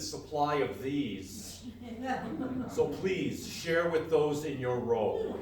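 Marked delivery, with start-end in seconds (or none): none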